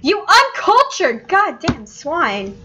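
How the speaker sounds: high, slow, drawn-out rebuke; mock outrage. Character scolding someone who missed the reference, mock outraged. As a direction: stretch out each indignant word, hammed up.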